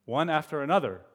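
put-on lively read-aloud for children; animated story narration; storybook reading. A narrator's reading. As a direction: read it like a bedtime picture book.

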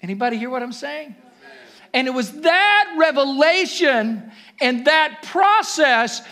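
loud high fervent preaching; preaching at full force. Acted. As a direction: build it to a shouting altar call. Preaching climax, building.

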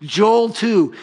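ordinary delivery

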